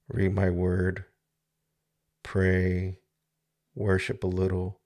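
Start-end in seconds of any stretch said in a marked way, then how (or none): none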